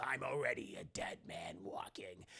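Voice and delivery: low whispered doom; hushed fatalistic whisper; grim whisper. Retold or imitated. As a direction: breathe it out like a dying man. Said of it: "He goes".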